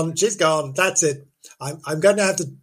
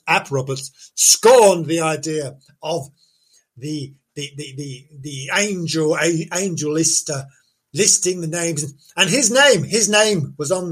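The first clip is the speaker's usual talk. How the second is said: ordinary delivery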